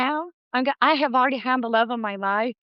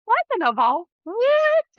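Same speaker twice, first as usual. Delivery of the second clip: high-pitched incredulous outburst; acted-out astonishment; retold shock; 12 semitones higher, 3 dB louder, slower than usual. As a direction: let the last word leap up in disbelief. Retells her shocked reaction to dating show.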